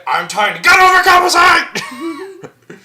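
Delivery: high, hammed-up roaring shout; Gimli. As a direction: bellow like a loud little warrior, drawn out. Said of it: "he speaks loud".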